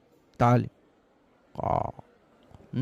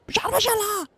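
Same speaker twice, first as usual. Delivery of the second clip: مش عارف أشيلها said much higher, falling on أشيلها; squeaky high complaint. Pretend. Third try at removing the item, comic high-pitched whine. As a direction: squeak it out in mock frustration. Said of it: "repeated in rising voices".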